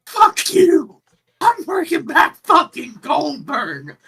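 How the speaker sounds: high, loud mock-furious outburst; hammed-up rage, yelled high; Triple H. Host acting out a pissed-off backstage retort, mock fury.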